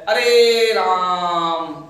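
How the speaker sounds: अरे राम held very slowly, vowels stretched; long drawn-out call. Shown as a demonstration. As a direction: draw out अरे राम like a calling chant. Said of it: "इस तरह से।".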